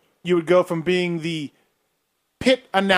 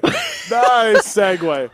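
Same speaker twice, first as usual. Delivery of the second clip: slow drawn-out; 3 semitones higher, much slower than usual. Acted. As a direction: stretch out each word slowly.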